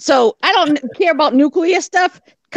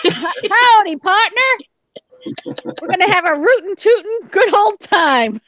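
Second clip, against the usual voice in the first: hammed-up Texas cowboy greeting, pitched up; higher, hearty mock-Texan accent; 4 semitones higher than usual. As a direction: put on a playful Texas twang. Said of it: "that was my Texas voice".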